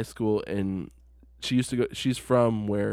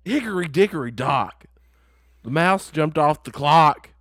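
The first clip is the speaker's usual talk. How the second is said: A J Styles bedtime story; 6 semitones higher, 6 dB louder than usual. Pretend. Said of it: "he's just like".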